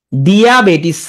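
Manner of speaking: diabetes as "dee-aa-bay-tis"; spelling pronunciation. Shown as a demonstration. Teacher voicing the spelling, drawn-out dee-aa in diabetes. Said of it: "আমি উচ্চারণ করে লেখো".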